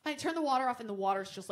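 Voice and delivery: normal talking voice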